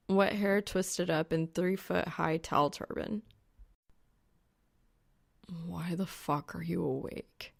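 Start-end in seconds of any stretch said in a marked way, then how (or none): 5.5-7.5 rasping. Acted. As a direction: rasp it out, barely awake. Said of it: "he rasps".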